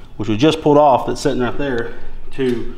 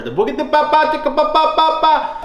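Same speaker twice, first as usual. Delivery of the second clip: high, rapid engine sputter noises; rev limiter sputter imitation; 14 semitones higher than usual. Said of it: "rev limit sputter".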